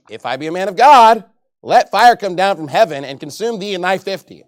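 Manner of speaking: loud, stern pronouncement of fire; Elijah's loud reply. Acted. Elijah answers the captain, loud stern judgment. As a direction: call down fire on them.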